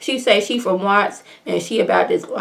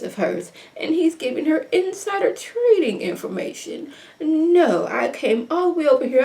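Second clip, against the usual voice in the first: bougie mom voice; 7 semitones higher, 5 dB quieter than usual. Retold or imitated. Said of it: "this bougie voice that the mom was giving".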